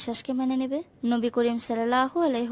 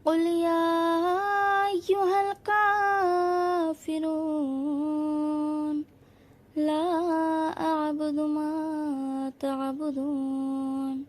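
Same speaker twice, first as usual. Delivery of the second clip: slow, high, long-drawn chant; melodic Quran recitation; 5 semitones higher, much slower than usual. Acted. Teacher recites Surah Kafirun before the lesson, slow melodic chant. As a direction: chant every word long and melodic, like a qari.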